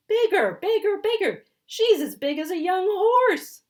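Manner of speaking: very high, loud repeated yelling; shrill-pitched insistent shout. Acted. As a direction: keep shouting, insisting harder. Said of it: "Lunchbox Louie shouted".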